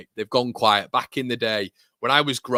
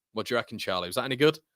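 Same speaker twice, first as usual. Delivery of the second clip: normal talking voice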